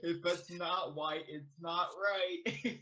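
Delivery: drawn out word by word, slow; slow mocking repeat. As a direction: savor each word slowly. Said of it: "repeats the quote slowly".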